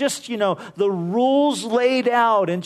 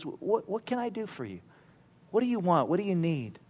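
gentle; 8 dB quieter, faster than usual. Retold or imitated. Jesus answering blind Bartimaeus gently.

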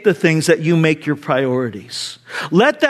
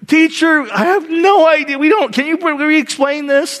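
higher, lost schoolboy asking teacher; confused student; 7 semitones higher than usual. Retold or imitated. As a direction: play the baffled kid with his hand up. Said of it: "George, you know, raises his hand".

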